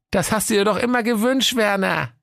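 a chirpy excited wife; Waltraud's voice. Acted. Wife showing her husband a bundle, high and eager.

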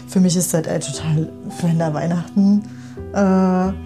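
ordinary talk, nothing marked

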